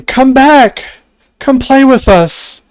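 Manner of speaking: high, loud sing-song calling from the mist; luring fog women. Acted. Unseen women in the fog luring the party, loud high calling. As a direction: call them back sweetly, like sirens in the fog. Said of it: "what sounds like women going".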